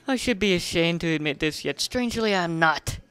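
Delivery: ordinary delivery, nothing marked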